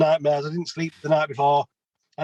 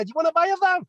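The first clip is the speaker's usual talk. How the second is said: much higher, mock passer-by greeting; crappy put-on accent; 13 semitones higher, faster than usual. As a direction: play the bloke on the street, pitched way up. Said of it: "Sorry about my crappy accent".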